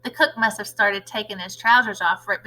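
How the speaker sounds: usual speaking voice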